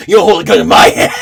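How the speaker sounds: fast, loud mock protest; loud outburst breaking into laughter; retold indignant shout. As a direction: yell it in pretend outrage. Acting out hostage negotiation quarrel.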